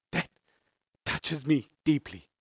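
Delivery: quiet, slow, voice breaking; fighting back tears; moved to tears mid-sermon; choked up. Real, not acted. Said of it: "Don't know why I'm bawling like a baby".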